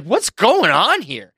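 high, drawn-out mock demand; alarmed police officer. Police finding two men with a hammer, alarmed.

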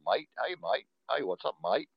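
put-on Aussie greeting; casual imitation of an Australian accent. Shown as a demonstration. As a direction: greet a mate in mock Australian. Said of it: "Aussies have an accent".

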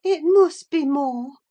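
high, loud, doubtful insistence; insistent invalid. Acted. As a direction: insist in a high-pitched fretful voice. Catherine doubts the time passed.

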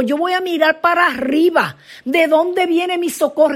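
high-pitched, urgent declaration; psalmist calling upward. Acted. Psalmist looks upward seeking help, high impassioned calling.

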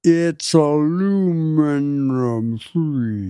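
drawn-out, slow-motion words; exaggerated slow talk. Mocking slow dictation to a note-taker, exaggerated slow talk. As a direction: stretch every syllable absurdly long.

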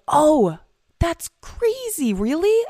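high, squeaky mock surprise; mock amazement. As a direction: gasp at it like a wide-eyed kid. Acting amazed at sober idea.

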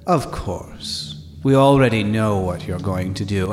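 mysterious voice. Acted. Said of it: "the voice says".

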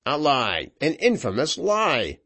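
a touch of fury, pitch lifted on the repeat; outraged cry. A narrator's reading. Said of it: "cried Vanslyperken".

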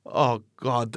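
normal talking voice

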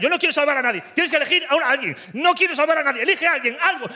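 high, pushy girlfriend voice in a retold fight; heated mock argument. Mimicking girlfriend as the fight heats up, high and insistent. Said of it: "ya se calentó y me dice".

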